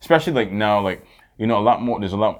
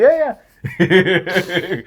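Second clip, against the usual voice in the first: laughing through a high yeah yeah; 8 semitones higher, 5 dB louder than usual. Real, not acted. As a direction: laugh it out, louder and higher. Laughing at the dog bit.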